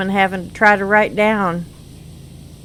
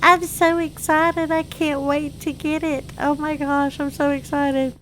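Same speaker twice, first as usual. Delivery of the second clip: excited mom; 7 semitones higher than usual. Retold or imitated. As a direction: play mom bubbling over with excitement, pitched up. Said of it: "And she's like".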